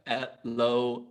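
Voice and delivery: lightly robotic echoed hello; imitated robot voice. Teacher copying a pupil's hello, light robot voice. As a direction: mimic a robot's two-syllable greeting. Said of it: "what does your robot voice sound like?".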